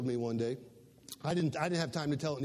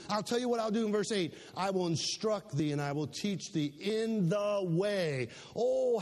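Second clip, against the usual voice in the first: higher, fervent pulpit delivery, building; preaching; 5 semitones higher, 4 dB louder than usual. Acted. Pastor preaching God's promises, fervent full voice. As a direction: preach it out with rising conviction.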